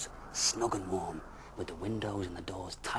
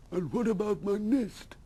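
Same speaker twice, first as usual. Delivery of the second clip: high, louder worried owl; 13 semitones higher, 7 dB louder than usual. Acted. Owl asking bear about his nest, high. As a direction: press him about the nest.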